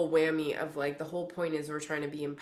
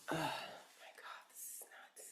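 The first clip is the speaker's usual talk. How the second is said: barely audible, amazed whisper; awed whisper; 16 dB quieter, slower than usual. Real, not acted. Reader amazed the hawk is nearby, awed whisper. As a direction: whisper under your breath in real amazement.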